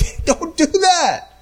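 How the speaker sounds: high-pitched, drawn-out acted warning; urgent warning. Pastor to someone going down that path, high urgent warning. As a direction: call them back from the wrong path.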